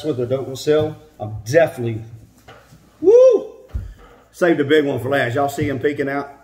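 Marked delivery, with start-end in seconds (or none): 3.0-3.5 very high, loud woo of delight; excited woo. Real, not acted. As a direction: burst out at a great find. Surprised by the big bear.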